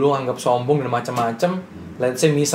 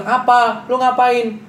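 voicing the mom; 11 semitones higher, 4 dB louder, much slower than usual. Retold scene with mom.

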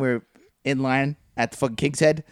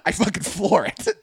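ordinary talk

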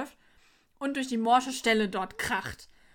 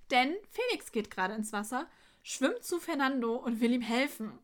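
usual speaking voice

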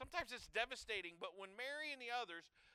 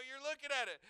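usual speaking voice

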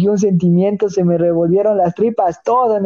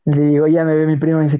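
ordinary delivery

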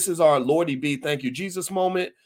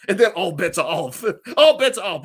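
laughing through the words, getting louder; 5 dB louder than usual. Real, not acted. Host jokes about the amendment's loophole, laughing. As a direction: crack up while saying it.

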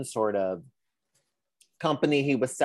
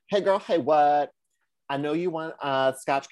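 sassy professor; 4 semitones higher than usual. Acted. Professor writing to whiskey maker seeking consultant, sassy greeting. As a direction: play the professor as a sassy girlfriend.

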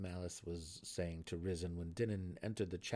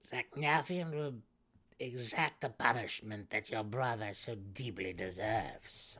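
cold, assured threat; menacing promise; 4 semitones higher, 4 dB louder than usual. A narrator's reading. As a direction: promise vengeance. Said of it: "Malice promised".

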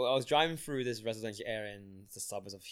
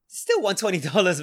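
higher, louder retold complaint; indignant protest; 6 semitones higher, 9 dB louder than usual. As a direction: protest in mock outrage.

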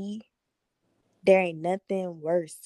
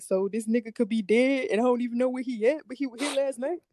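retold tearful worry, pitched higher; mock emotional; 4 semitones higher than usual. Woman recalling her missing boyfriend, mock emotional. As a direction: imitate yourself drunk and upset, voice climbing. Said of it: "So I start crying 'cause I was still kinda drunk".

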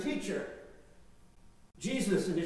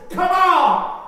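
emphatic shout; 10 semitones higher, 14 dB louder than usual. Shown as a demonstration. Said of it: "to get the emphasis of come".

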